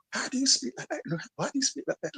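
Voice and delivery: high-pitched mock puzzlement; lightly acted, high incredulous questioning; imitating puzzled friends. Friends puzzled by his home speech.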